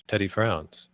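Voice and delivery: usual speaking voice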